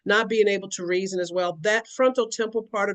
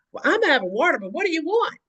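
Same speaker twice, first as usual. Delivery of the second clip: pushy repeat question; 6 semitones higher, faster than usual. Retold or imitated. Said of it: "she's like".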